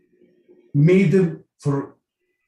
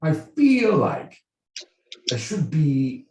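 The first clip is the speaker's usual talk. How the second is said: put-on royal British accent; 5 dB quieter than usual. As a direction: put on a regal British accent, pausing between phrases. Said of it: "in the royal British accent".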